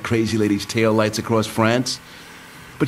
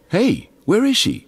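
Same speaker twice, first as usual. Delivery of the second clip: startled, slow, higher; alarmed double-take; sudden surprise; 12 semitones higher than usual. Acted. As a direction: notice she's gone and jolt. Suddenly notices Shiva missing.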